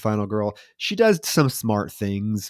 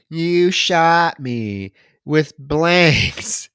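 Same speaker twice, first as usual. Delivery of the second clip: slow, loud, drawn-out mock taunt; taunting killer; 5 semitones higher, 5 dB louder, much slower than usual. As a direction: stretch the words like a gloating villain. Killer's taunt, acted slowly.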